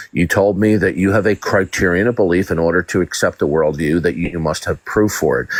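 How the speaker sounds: ordinary delivery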